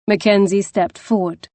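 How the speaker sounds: ordinary delivery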